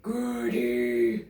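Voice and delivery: low, croaky monster voice; croaking dinosaur. A narrator's reading. Hungry dinosaur eyeing Dad, croaking. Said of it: "croaks Grizzle".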